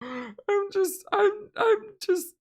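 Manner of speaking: high, squeaky crying laughter; can't get words out for laughing; real tearful laughter; laughing to tears. Real, not acted. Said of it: "I'm just enjoying this so much".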